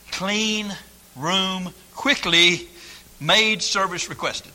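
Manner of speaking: very slow, word by word; deliberate sign reading. Retold or imitated. Preacher reading the backwards sign, slow deliberate delivery. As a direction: spell out the embarrassing sign slowly, pausing between phrases. Reads out the sign's wording.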